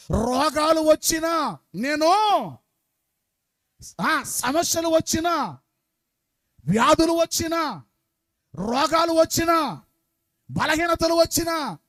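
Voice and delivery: high, loud, slow preaching shout. Real, not acted. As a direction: shout each line to the crowd. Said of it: "ఇంత గట్టిగా అరిచినా". (గట్టిగా, ఎత్తైన స్వరంతో అరవడం)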